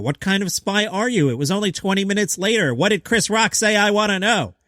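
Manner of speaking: ordinary talk, nothing marked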